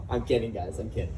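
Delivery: soft laugh through the words; laughing aside. Real, not acted. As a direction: giggle it out.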